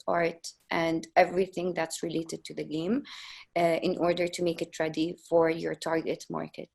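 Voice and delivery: ordinary talk, nothing marked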